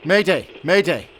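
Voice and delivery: loud, high distress call; urgent radio shout. Acted. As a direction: yell Mayday into the radio like lives depend on it. Emergency call over the radio.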